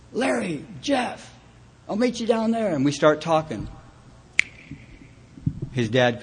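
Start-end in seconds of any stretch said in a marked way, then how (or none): 0.1-2.7 high, carrying call across the escalators; lightly voiced call to a friend passing by. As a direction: sing out his name to catch him going the other way. Calling out to Jeff across escalators.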